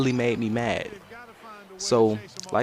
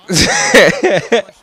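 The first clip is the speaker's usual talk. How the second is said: sudden high burst of laughter; loud high real laugh; 12 semitones higher, 13 dB louder than usual. Real, not acted. Laughs at own story.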